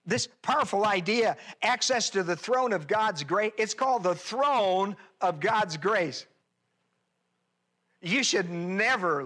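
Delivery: preaching. Acted. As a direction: proclaim it to the congregation. Preacher opening at full force.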